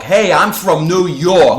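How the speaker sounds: loud, high, slow, heavily pushed New York accent; overdone New York accent. Shown as a demonstration. Showing how false accents sound, exaggerated New York accent. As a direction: lay the New York accent on far too thick. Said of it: "lay on the New York".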